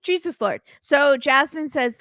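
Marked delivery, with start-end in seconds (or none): 0.0-0.6 higher mock Florian catchphrase; imitating Florian. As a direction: toss off his pet phrase in his voice. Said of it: "Why is he saying Jesus Lord".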